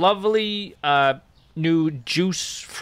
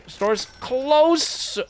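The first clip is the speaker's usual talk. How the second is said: higher and louder, fed-up shout at a late customer; exasperated cry; 10 semitones higher, 5 dB louder than usual. Real, not acted. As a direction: blurt it out, annoyed. Customer walks in after closing.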